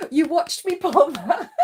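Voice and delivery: high, loud and laughing; delighted, laughing disbelief; laughing exclamation. Real, not acted. Repeated with laughter.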